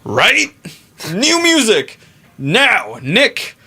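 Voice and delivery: high-pitched mock urgency, rallying the band; hyped-up demand. Bandmate retelling how they rushed Nick into new music, hyped high-pitched demand. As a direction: bark orders in a squeaky, excited put-on voice.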